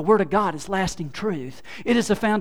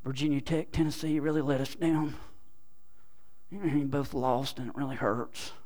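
muttering; 3 semitones lower, 7 dB quieter than usual. Shown as a demonstration. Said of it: "Mutter, mutter".

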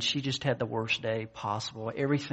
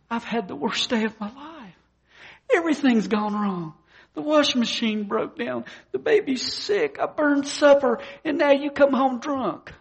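high-pitched mock wail, building louder; hammed-up bawling; 11 semitones higher, 5 dB louder than usual. Wife bawls to her husband about her awful day, hammed-up. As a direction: wail out each complaint, imitating an overwrought wife. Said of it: "just started bawling her eyes out".

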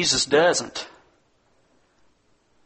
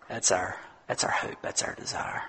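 hushed; 6 semitones lower, 10 dB quieter than usual. Real, not acted. Sermon hope, hushed.

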